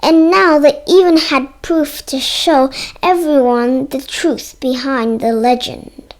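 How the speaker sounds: ordinary delivery, nothing marked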